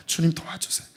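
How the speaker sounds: voiceless hushed prayer 주님 도와주세요; whispered plea. Pretend. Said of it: "주님, 내 힘으로 안 됩니다".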